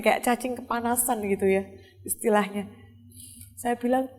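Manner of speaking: ordinary talk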